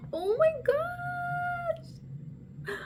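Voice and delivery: long high squeal of joy; delighted squeal. Real, not acted. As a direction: draw out a thrilled high cry. Overjoyed with the shoes.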